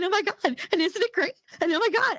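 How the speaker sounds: mock the trembling caseworker; shaky caseworker imitation. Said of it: "shake and talk like this".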